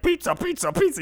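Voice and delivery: put-on high-pitched four-year-old clamoring for pizza; mock kid voice, way up high; excited little kid. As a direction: squeal it like an overexcited small child. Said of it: "The four-year-old kid, he's just like".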